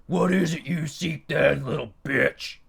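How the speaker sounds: loud gruff domineering demon. A narrator's reading. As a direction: put the servant in his place. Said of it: "he replied".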